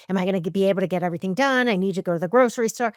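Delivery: fast, piling thoughts on top of each other; rushed anxious thoughts. Retold or imitated. Host retelling her morning worries, fast and anxious. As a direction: rattle off the worries in a rush. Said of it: "and I'm like".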